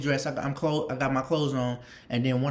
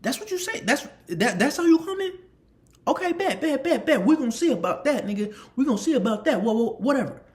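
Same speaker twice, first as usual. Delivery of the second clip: higher, indignant big lineman; mock offended teammate; 8 semitones higher than usual. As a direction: act wounded then threaten him. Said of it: "He was like".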